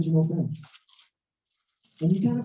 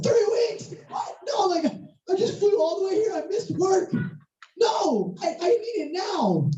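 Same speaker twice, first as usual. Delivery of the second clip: high-pitched wailing mock protest; acted panicky outburst, much higher; outraged disbelief; 12 semitones higher than usual. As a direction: squeal in hammed-up dismay at the clerk. Outraged customer at the bank.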